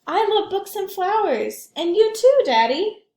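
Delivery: put-on high, loud, eager child; a small girl gushing to her daddy; little girl voice. Child Alma speaks to father.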